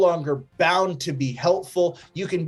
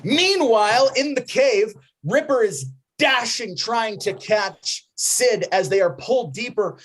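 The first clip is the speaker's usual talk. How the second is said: excited narration; 6 semitones higher than usual. A narrator's reading. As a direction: narrate the chase with urgency. GM narrates the cave scene.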